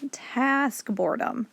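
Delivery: ordinary delivery, nothing marked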